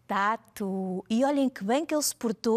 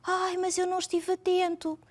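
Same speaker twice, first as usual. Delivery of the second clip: put-on child's whine; 6 semitones higher than usual. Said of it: "meninos que estão a dizer".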